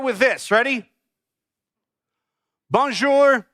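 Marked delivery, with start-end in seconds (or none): 2.7-3.5 bright-pitched, clumsy American-style French greeting; clumsy French accent. Shown as a demonstration. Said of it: "a better accent than that".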